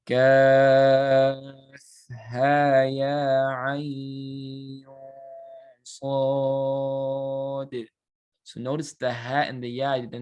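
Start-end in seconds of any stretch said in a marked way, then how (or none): 0.1-7.9 "Kaf" long and loud, "ha" "ya" short, "ain" and "sad" long; model recitation. Shown as a demonstration. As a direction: recite the letters, stretch the long ones. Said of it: "you would read like this".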